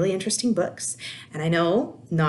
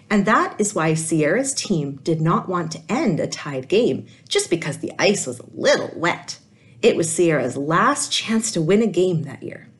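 put-on lively read-aloud voice for kids; animated children's story reading; storybook narration; 5 dB louder than usual. A narrator's reading. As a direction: tell it like a picture book to a class. Teacher reads picture book aloud.

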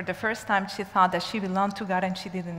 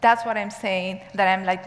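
normal talking voice

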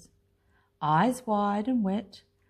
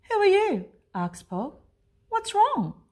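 higher, louder curious questions; a little monster asking, pitched high; gentle curious Pog; 9 semitones higher, 3 dB louder than usual. A narrator's reading. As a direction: ask the crying child kindly.

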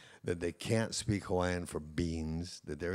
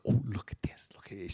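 hushed, whispery wonder at a find; imitated delighted discovery. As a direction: whisper it like showing off a treasure. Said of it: "and she'd go".

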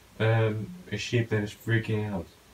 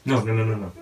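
panicked no; 3 semitones higher, 7 dB louder, faster than usual. Real, not acted. Witch attacking.

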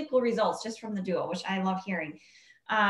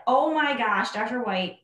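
quoting a thrilled patient, pitch climbing higher and faster; excited patient; 6 semitones higher, 4 dB louder than usual. Retold or imitated. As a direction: gush it like a customer who can't believe the results. Patient's excited report, quoted.

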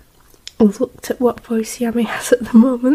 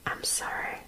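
breathy hushed whisper; 20 dB quieter than usual. Real, not acted. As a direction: whisper so the mic barely hears. Recording quietly.

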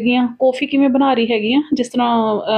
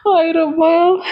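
laughing exclamation; 6 semitones higher, much slower than usual. Real, not acted. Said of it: "ਬਹੁਤ ਹਸੀ ਮਜ਼ਾਕ ਹੋ ਗਿਆ".

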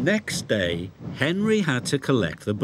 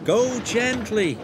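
calling driver; 10 semitones higher, slower than usual. Acted. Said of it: "called his driver".

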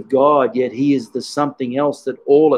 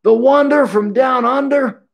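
much higher, beaming mock voice of a delighted old teacher; 11 semitones higher than usual. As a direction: greet a favourite student on the phone with joy. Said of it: "He goes".

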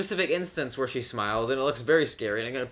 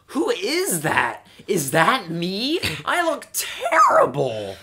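high, loud, drawn-out dismay; hammed-up diva outrage at her own photo; mock horrified star; 5 semitones higher, 7 dB louder, much slower than usual. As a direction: wail it slowly in exaggerated horror. Mimicking horrified star seeing photo.

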